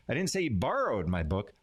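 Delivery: "borrowed" punched above the other words; stress on BORROWED. Shown as a demonstration. Teacher showing how emphasis changes meaning, stress on "borrowed". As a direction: hit the word "borrowed". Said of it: "putting emphasis on different words".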